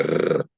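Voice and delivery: a trilled "Rrr" sound; Afrikaans rolled R. Shown as a demonstration. Actor showing the host how Afrikaans sounds, rolled R. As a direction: roll a sustained tongue-trill R. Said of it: "only in Afrikaans".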